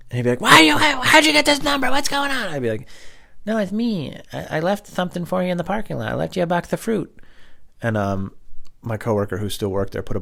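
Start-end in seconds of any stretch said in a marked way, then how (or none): 0.4-2.5 high, loud flustered demand; agitated yelling. Retold or imitated. Prank victim answers the caller, high agitated yelling. 3.5-7.1 high, put-on phone voice; put-on caller voice. Caller tells victim about the fruit, high put-on voice.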